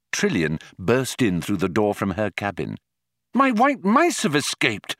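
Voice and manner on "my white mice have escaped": high-pitched, urgent announcement; alarmed woman. A narrator's reading. Trillian bursting in from her cabin, high and alarmed. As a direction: burst in with the news, voice way up.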